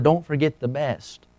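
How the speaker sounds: soft, slow, hushed warning; retold whisper. A narrator's reading. Mysterious voice warning the man in the mountain, hushed. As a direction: voice it softly, like a whispered reminder. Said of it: "the voice whispered".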